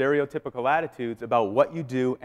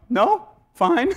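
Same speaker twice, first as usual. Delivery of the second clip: fake cool; 12 semitones higher, 7 dB louder than usual. Feigning calm while terrified.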